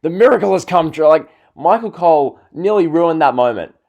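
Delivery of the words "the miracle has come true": raised, louder hammed-up commentator yell; mock screaming. Vlogger mocking the commentator's WrestleMania call, mock screaming. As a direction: imitate an announcer losing it over a big win. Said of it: "he was screaming".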